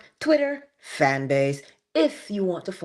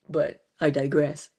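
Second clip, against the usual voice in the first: ordinary talk, nothing marked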